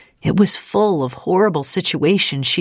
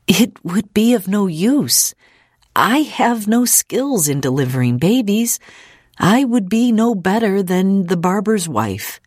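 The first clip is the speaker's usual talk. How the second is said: higher-pitched, earnest refusal; earnest Ida; 6 semitones higher than usual. Acted. Ida tells visitor she cannot deliver babies, earnest. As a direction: decline earnestly in a raised pitch.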